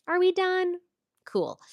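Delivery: high-pitched, loud, sarcastic question; sarcastic dismissal. Real, not acted. Vlogger finishes telling off the trolls, sarcastic.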